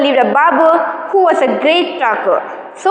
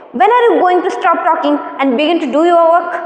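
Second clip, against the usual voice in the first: ordinary delivery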